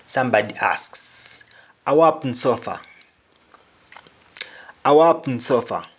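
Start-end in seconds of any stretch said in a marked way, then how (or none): 1.8-5.9 patois How happened so far; Jamaican patois. Shown as a demonstration. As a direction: ask in patois. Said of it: "What's happening so far".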